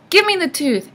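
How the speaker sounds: put-on high, loud old-hag demand; quarrelsome demand. A narrator's reading. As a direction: demand it crossly from your sister. Old sisters fight over tooth.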